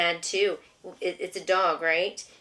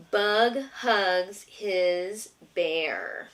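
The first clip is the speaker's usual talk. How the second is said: slow, drawn-out storybook line; much slower than usual. A narrator's reading. Mother reads to her toddler, slow and drawn out. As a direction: stretch each word out slowly for the child. Reading the book aloud.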